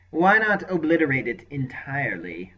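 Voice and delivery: eager, delighted question; jubilant. Acted. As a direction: relish the idea, gleeful. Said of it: "Malastair sounded jubilant".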